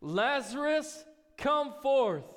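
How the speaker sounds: a loud, high, slow call to the dead man; loud cry. Acted. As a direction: call him out of the tomb, slow and booming. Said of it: "he cried out in a loud voice".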